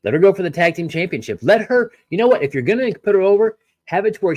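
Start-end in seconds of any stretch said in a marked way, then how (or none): 1.4-4.3 excited. Real, not acted. Excited pitching of storyline idea.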